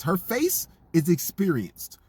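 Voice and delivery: each word spaced out; slow, pointed delivery. Acted. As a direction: lay it on slowly and knowingly. Mocking the actress's age.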